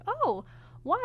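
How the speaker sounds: surprised question. Retold or imitated. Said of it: "And I was like".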